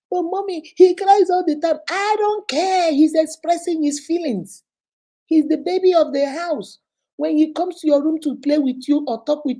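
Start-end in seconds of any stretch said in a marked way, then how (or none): 0.1-1.8 put-on higher, louder childish protest; daughter's whine. Daughter pleading her case to mommy, whiny and high. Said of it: "daughter's protest retold". 1.9-8.6 higher, louder, stern mother; firm scolding. Retold or imitated. Mother scolding her daughter for teasing, stern and raised.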